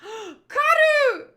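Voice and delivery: high, loud gasped-in shout; alarmed cry. Acted. Crew member crying out for Karu, alarmed shout.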